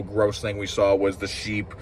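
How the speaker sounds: ordinary talk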